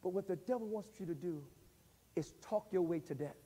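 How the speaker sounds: soft, low preaching over music; hushed preaching. Acted. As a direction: draw the congregation close and quiet.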